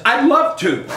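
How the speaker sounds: high, bright burst of I'd love to; thrilled high I'd love to; eager yes. Retold or imitated. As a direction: blurt it out running up. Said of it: "comes running down the hall going".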